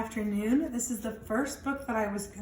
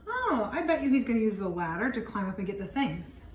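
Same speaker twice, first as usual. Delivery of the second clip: ordinary delivery, nothing marked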